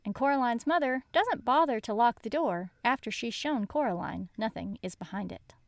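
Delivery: plain reading voice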